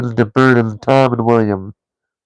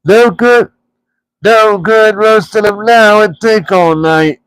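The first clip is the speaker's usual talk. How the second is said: high, loud mock troll grumble; a troll complaining in the dark; 9 semitones higher, 3 dB louder than usual. A narrator's reading. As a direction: Gandalf mimicking a troll to start a fight. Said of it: "said a voice".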